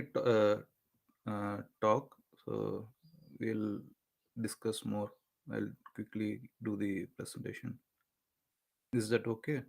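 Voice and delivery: very soft, distant voice; talking far too quietly into the mic; barely audible. Real, not acted. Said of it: "your voice is very low".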